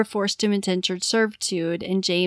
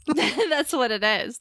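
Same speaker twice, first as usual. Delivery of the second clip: laughing; 4 semitones higher, slower than usual. Real, not acted. Joking about the Irish girl.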